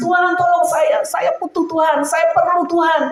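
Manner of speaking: high, pleading sob to God; imitating her own crying prayer; retold tearful prayer. Preacher retelling praying in her room, high and pleading. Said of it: "saya nangis".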